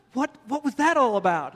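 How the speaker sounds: a hint of an annoyed fiancée; exasperated demand.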